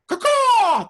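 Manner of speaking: loud high crow caw; crow call. Retold or imitated. Imitating the neighbor's morning crow noises, loud caw. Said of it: "woke up the neighborhood with crow noises".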